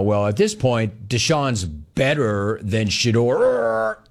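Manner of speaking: mock casual slip. Host mimicking a coach's supposed slip, slow mockery. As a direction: draw it out as an absurd imitation. Mocks an unlikely coach statement.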